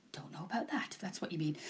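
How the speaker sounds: quiet aside. Real, not acted. Muttered aside.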